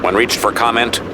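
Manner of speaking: normal talking voice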